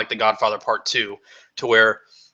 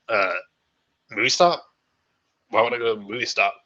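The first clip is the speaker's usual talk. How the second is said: lightly voiced, puzzled movie-star impression; a half-hearted impression, questioning the invitation; Michael Keaton. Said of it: "That was a terrible Michael Keaton impression".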